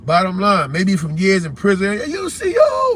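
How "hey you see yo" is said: mock raised voice. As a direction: imitate someone hollering, voice shooting way up.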